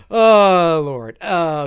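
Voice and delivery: ordinary talk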